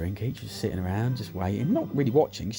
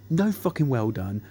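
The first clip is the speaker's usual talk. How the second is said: usual speaking voice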